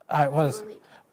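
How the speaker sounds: normal talking voice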